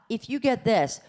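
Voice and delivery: normal talking voice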